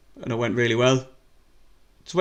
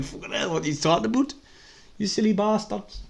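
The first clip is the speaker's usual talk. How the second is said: a weak, put-on Scottish accent, higher; hammed-up Scottish accent, barely there; 8 semitones higher, 4 dB quieter than usual. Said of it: "That was me Scottish accent".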